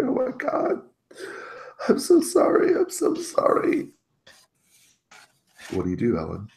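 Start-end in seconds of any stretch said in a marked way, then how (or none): 0.0-3.9 very high, squeaky acted sobbing; high-pitched tearful begging, played up; bawling apology. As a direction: wail it like a terrified man crying. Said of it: "he just begins bawling".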